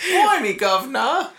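high, loud mock-Cockney British; hammed-up British accent. Co-host joining the British baby joke, hammed-up high British accent. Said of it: "A New York British".

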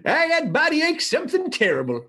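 lightly hammed-up silly voice; put-on impression voice. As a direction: start high, then drop for the mock complaint. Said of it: "just did it that way".

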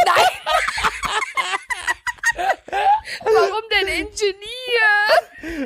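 high crying laughter; laughing. Real, not acted. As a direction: wheeze the question out laughing. Laughing disbelief.

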